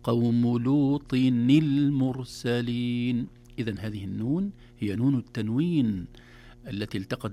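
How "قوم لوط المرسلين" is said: "لوط المرسلين" joined as lutinil-mursalin; very slow recitation, linking the words with an i; kasra on tanween. Shown as a demonstration. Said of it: "يتحرك إلى الكسر مثل هذه". ("لوط المرسلين" بتحريك النون بالكسر)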